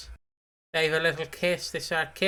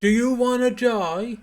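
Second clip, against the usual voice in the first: slow, loud, raised question; Ross Kemp impression; 6 semitones higher, 6 dB louder than usual. Co-host trying Grant's line, Ross Kemp impression. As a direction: stretch it out in a put-on forceful voice. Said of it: "I can't do a Ross Kemp impression".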